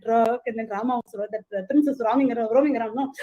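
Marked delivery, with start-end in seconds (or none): none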